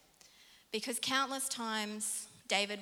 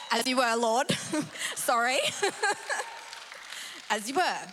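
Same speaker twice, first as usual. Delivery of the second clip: loud, high, laughing "as you were"; laughing shout of surrender; loud mock salute; 5 semitones higher, 7 dB louder than usual. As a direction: bark it out like a soldier's dismissal.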